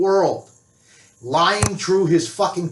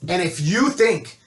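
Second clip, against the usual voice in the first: ordinary talk, nothing marked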